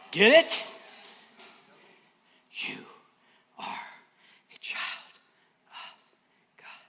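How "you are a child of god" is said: whisper. Acted. Intimate climax.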